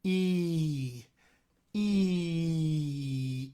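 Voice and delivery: E held as a long spread-lip ee; long ee vowel. Shown as a demonstration. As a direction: sing out a sustained ee with spread lips. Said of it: "I get something a little bit like this".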